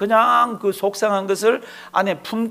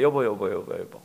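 low, soft coaxing 여보; a husband gently calling his sad wife; lightly voiced husband soothing his wife; 7 semitones lower, 5 dB quieter than usual. Husband consoling crying wife.

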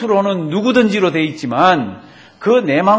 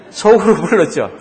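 ordinary talk, nothing marked

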